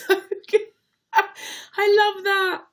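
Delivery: high, loud squeal of laughter; delighted laughing. Real, not acted. Delighted by a fan's tip.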